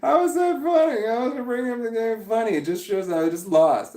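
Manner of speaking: high, loud indignant disbelief; indignant protest. Real, not acted. Streamer protesting to chat who laughed at his loss, indignant. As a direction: demand to know why it's funny.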